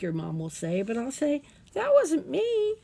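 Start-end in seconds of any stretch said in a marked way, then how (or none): 1.7-2.7 put-on indignant child protest. Child protesting to mom that the dragon did it, loud. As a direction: insist it wasn't you. Child denies blowing bubbles.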